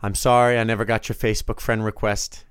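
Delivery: ordinary talk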